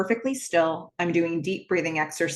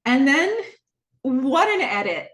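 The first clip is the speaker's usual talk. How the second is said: laughing; 5 semitones higher, 3 dB louder than usual. Real, not acted. Delighted laugh at the edit.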